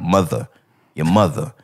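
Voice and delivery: "Mother" with the final ER dropped to an A; "Mother" ending in an open A, no R; East Coast pronunciation. Shown as a demonstration. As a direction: say "Mother" as muh-thuh, low. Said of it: "That's how they sound".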